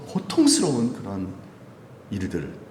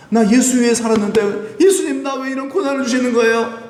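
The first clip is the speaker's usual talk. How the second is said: high, loud aggrieved plea; upset lament; 10 semitones higher, 3 dB louder than usual. Acted. Apostles asking Jesus why they suffer, upset lament. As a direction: cry out in hammed-up protest. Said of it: "얼마나 속상하겠어요".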